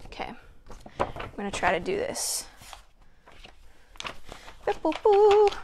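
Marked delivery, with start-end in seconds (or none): 4.7-5.5 boop along playfully up high; little boop noises. Real, not acted. Little noises while adjusting something.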